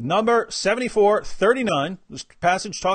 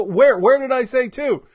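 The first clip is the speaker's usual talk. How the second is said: exasperated retort; 5 semitones higher, 4 dB louder than usual. Retold or imitated. Acting out his own exasperated reply.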